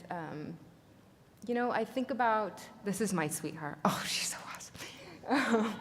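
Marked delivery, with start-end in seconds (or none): 3.8-5.7 slow laughing whisper over a photo; a slow, giggly whisper of delight; whispered gush. Real, not acted. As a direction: whisper it fondly, almost to yourself. Gushing over daughter's photo.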